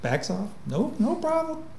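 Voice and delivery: sweet and endlessly patient; gentle robot voice. Retold or imitated. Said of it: "The robot says".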